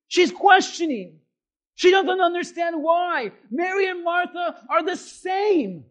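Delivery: high, loud shouted preaching; full-force preaching. Real, not acted. Preacher voicing Mary's complaint to the congregation, full-force shouting. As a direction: drive every phrase out hard and high.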